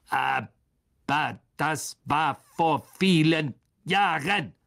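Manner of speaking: langsam und gedehnt, unwirsch; ungehalten. Acted. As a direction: sag es ungeduldig und langsam. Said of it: "sagte Aragog ungehalten". (ungehaltene alte Spinne)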